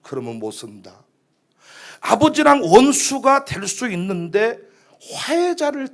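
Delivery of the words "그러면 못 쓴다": very soft, low motherly reproof; gentle admonishing. Acted. Mother corrects her sulking child, soft and gentle. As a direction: quietly correct a sulky child.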